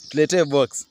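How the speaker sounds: normal talking voice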